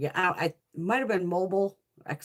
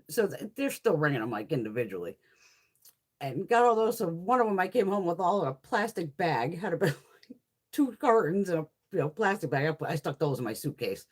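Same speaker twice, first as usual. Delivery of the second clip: ordinary talk, nothing marked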